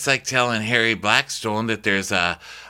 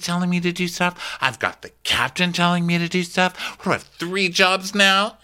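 high-pitched whiny grumble, mimicking; hammed-up griping; mock bitching; 9 semitones higher than usual. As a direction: complain to yourself in a put-upon high voice. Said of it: "[name]'s just bitching to herself".

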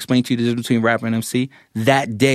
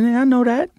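unimpressed; 12 semitones higher than usual. Retold or imitated. Shrugging off the song.

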